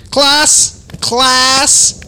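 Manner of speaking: high, loud, drawn-out call to the class; teacher calling the room to order in a high voice; Becky voice. Retold or imitated. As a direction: summon the class like a chirpy teacher. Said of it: "her Becky voice".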